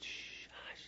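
whispered, drawn-out shush; hushed crowd. Retold or imitated. Preacher retells the concert hall going quiet, hushed whisper. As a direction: hush it like a crowd falling silent. Imitates crowd falling silent.

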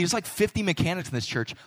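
ordinary talk, nothing marked

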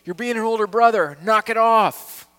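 loud, high rebuke, slowing at the end; calling someone out. Pretend. Congregation member rebuking an older brother, loud and firm. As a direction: snap them out of it firmly. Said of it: "call out the older brother and go".

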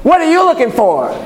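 high-pitched, punchy challenge; demanding tone. Shown as a demonstration. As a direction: fire it off as a high, pointed question. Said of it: "Like you could say".

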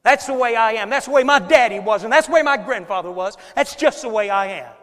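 loud, fast, defiant insisting; loud mock-stubborn churchgoer; stubborn excuse. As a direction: proudly blame it on the family. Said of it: "we say it about like that".

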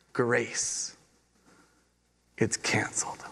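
whispered and soft, reverent; hushed whisper. Real, not acted. Pastor telling the congregation their debt is gone, whispered. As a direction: breathe it like a secret. Climax of grace illustration.

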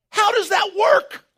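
high, slow shout of wonder; amazed exclaim. Real, not acted. Preacher marveling aloud at the endless oil. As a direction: throw the question up to the rafters.

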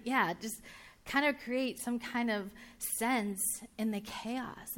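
ordinary talk

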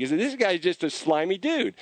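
usual speaking voice

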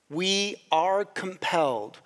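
slow, weighty "We are compelled"; emphatic restatement. Real, not acted. Preacher driving home the gospel point, slow emphatic "We are compelled". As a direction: space out "We are compelled" word by word. Restates conviction more firmly.

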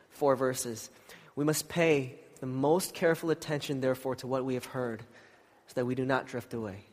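scripture reading. Acted. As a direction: read the passage reverently.

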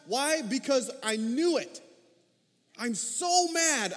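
high, heated protest; exceedingly angry. Acted. Preacher as Jonah complaining to God, angry. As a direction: vent it, drawing out the fury. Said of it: "He was displeased and exceedingly angry".

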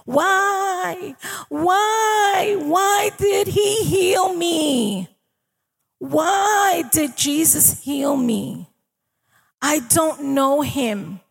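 high, drawn-out wail of disbelief; imitated crying. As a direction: cry out slowly, imitating a weeping girl. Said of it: "she's crying, and she's saying".

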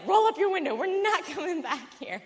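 loud and high, laughing at the end; urgent yell. Retold or imitated. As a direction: order her to roll the window up, alarmed. Urgent order while driving off.

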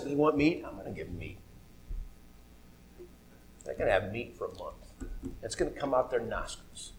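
low, hushed mock divine threat; mock God voice. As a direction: voice God low and ominous, half joking.

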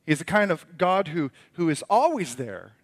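usual speaking voice

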